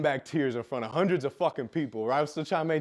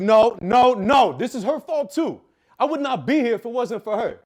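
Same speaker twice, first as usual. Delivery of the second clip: loud, high, slow, desperate no; loud anguished denial, drawn out; anguished shout; 8 semitones higher, 9 dB louder than usual. Retold or imitated. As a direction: cry out against it in anguish. Reliving refusal at suicide moment.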